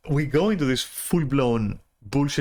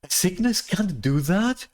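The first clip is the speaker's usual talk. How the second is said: mocking her; 7 semitones higher than usual. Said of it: "she goes and says things like".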